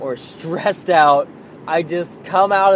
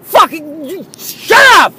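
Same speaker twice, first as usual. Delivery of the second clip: much higher and louder furious shout; full yell; 14 semitones higher, 14 dB louder than usual. Pretend. Imagined dad losing it at his daughter, screaming. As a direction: scream it at the kid, all out. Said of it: "would it be easier to just be like".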